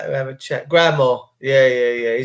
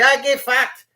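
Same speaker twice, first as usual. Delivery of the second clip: a much higher, hammed-up Australian accent; mock Aussie accent; 9 semitones higher than usual. Mimicking Aussie slang, in a high mock Aussie accent. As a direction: fling it off like a brash Aussie. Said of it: "Whenever you go to Aussie".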